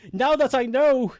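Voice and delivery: high, loud lament; dismayed outburst. Real, not acted. Streamer can't unsee missing ears, dismayed outburst.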